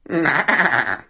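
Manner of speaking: wavering imitation goat bleat. Goat sends dog on his way, bleating. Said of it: "with a ... sound".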